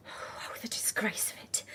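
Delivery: breathy hushed lament; hushed mock shame. Landlady laments her own spying in a hushed hammed-up voice. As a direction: whisper the shame grandly.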